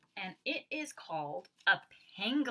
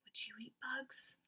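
unvoiced conspiratorial question; whisper; 4 semitones higher, 9 dB quieter than usual. Real, not acted. Teacher whispering a silly question to the kids. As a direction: whisper it to them.

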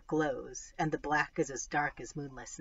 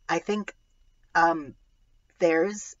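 put-on loud, higher, halting child voice; urgent hesitation; 3 semitones higher, 8 dB louder, much slower than usual. A narrator's reading. Lily trying to warn her mom of a tiger, urgent hesitation. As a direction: stammer out the news, raised and urgent.

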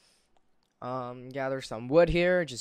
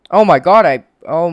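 loud frustrated cry; 4 semitones higher, 16 dB louder than usual. Real, not acted. Dies in game, exclaims.

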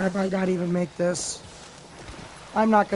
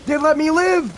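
excited; 7 semitones higher, 4 dB louder than usual. Real, not acted. Surprised survival in game.

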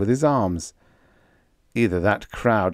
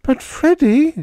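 much higher, louder, slow wail; 7 dB louder, slower than usual. A narrator's reading. Panda wailing at Freddie in fear for him. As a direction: wail his name in distress. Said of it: "she wailed".

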